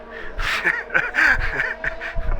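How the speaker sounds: airy high-pitched laugh of disbelief; high breathy laugh. Real, not acted. Can't watch own stream.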